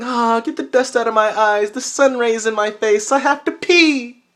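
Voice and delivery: high, hammed-up morning complaint; acted waking-up whine. Waking with sun in his face, hammed-up whine. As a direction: whine it high, stretching the last words. Acts out waking up needing pee.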